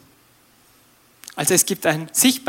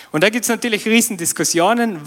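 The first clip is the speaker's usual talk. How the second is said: normal talking voice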